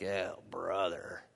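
low, soft, unimpressed mumble; flat-out sarcastic teen mumble; bored sarcastic reply. Retold or imitated. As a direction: grumble it like a bored kid. Kids' bored reply to dad.

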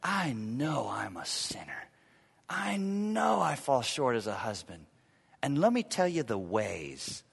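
hushed whisper, then soft; a husband owning his faults, hushed; humble confession. Shown as a demonstration. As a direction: confess quietly and sincerely. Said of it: "Instead of".

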